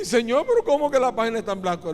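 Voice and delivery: loud, high, rushed disbelief; astonished protest. Acted. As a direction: burst out in acted astonishment. Sinner astonished at the blank page. (protesta asombrada, fuerte y atropellada)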